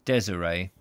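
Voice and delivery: "Desiree" as DEZ-uh-ray; corrected pronunciation. Shown as a demonstration. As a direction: practise "Desiree" with first-syllable stress and a ray ending. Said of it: "Desiree, not Desiree".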